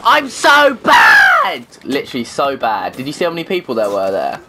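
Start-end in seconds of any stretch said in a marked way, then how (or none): 0.0-1.7 high, slow triumphant yell; gleeful bellow; gloating shout. Real, not acted. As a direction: crow it out loud and slow. Gamer gloating after a kill.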